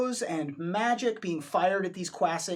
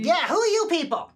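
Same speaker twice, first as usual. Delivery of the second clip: louder, higher demand; demanding guard; 11 semitones higher, 6 dB louder than usual. Acted. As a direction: press them hard for an answer. Guard repeats challenge louder.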